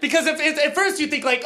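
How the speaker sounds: normal talking voice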